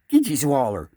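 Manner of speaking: loud call to the fish; catchphrase call; bait call. Pretend. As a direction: holler it out over the lake.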